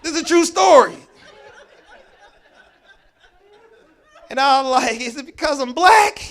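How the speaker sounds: laughing loud and high while telling it. Real, not acted. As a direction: laugh through the memory. Laughing recounting.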